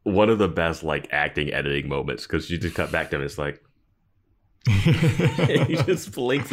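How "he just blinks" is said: high, slow, laughing through the words; laughing while retelling. Real, not acted. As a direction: giggle it out. Laughing recounting Oz's blink.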